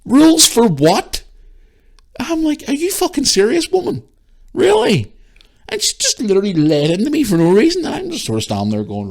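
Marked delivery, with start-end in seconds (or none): 0.0-1.2 loud, high, drawn-out disbelief; incredulous challenge. Retold or imitated. Shopper questioning the woman's rules, incredulous challenge. Retelling an argument in store. 2.7-5.1 very high incredulous retort; outraged disbelief. Retold or imitated. Shopper answering the woman's rules, outraged disbelief. Retelling an argument in store.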